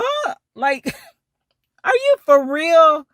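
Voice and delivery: high incredulous huh with a laugh; mock disbelief. To the driver, a high laughing disbelief. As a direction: squeak out disbelief, half laughing.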